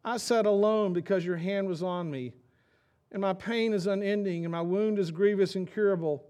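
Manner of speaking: loud, high reading of a lament; scripture reading. Acted. Pastor to congregation, reading Jeremiah's lament aloud, declaiming. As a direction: declaim the verse like a grieving prophet.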